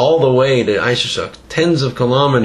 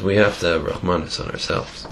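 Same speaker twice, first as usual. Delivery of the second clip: soft, lower solemn voice; quiet; 6 semitones lower, 7 dB quieter than usual. Retold or imitated. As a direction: say it softly, sincerely. Quiet closing moral.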